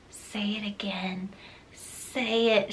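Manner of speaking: breathy whisper, pleading softly; imitating a whispered plea. Dorian asking her to say his name, mock whisper. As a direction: beg in a hush, almost no voice. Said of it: "he was whining".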